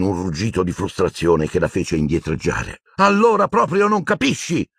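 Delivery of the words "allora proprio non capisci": frustrated high shout at Hermione; Harry losing his temper; shouting at her. A narrator's reading. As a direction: yell it in frustration. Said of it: "le urlò contro".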